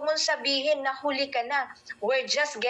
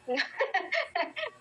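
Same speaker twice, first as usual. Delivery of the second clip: real laugh; 8 semitones higher, 4 dB quieter than usual. Real, not acted. Laughing at her own slip.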